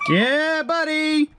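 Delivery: high, drawn-out cheer; real jubilation. Real, not acted. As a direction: crow in triumph, voice up high. Celebrates the car running.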